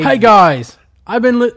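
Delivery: loud hammed-up Aussie accent; mock Australian-British accent. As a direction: belt it in a fake Aussie-British voice. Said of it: "it goes into Australia".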